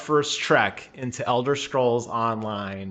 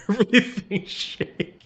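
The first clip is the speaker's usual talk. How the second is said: laughing; 6 semitones higher, 3 dB quieter than usual. Real, not acted. Laughing at overmodulating mic.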